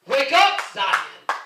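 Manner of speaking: loud preacher's wake-up call; rousing shout. Acted. Preacher telling Zion to wake up, rousing shout. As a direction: shout to rouse a sleeping people.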